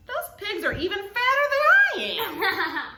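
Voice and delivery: loud amused grumble; mayor's thought. Acted. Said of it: "what he thought was".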